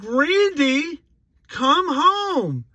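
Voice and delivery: very high, loud long-distance call; mom yelling. Retold or imitated. Mom ordering her son home from across the yard, yelling. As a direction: holler like a mother calling her child in. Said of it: "and she yells".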